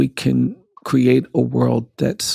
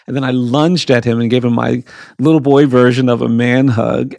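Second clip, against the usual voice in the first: usual speaking voice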